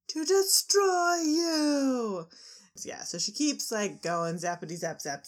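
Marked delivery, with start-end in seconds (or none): 0.1-2.3 slow, much higher and louder villain cry; hammed-up villain declaring doom; villain threat. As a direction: stretch it out like a cartoon villain.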